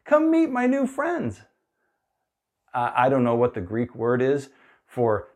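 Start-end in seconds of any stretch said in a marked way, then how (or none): 0.0-1.4 put-on high, bright boyish greeting; breezy kid calling out to his parents; cheerful young Jesus. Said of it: "Jesus going".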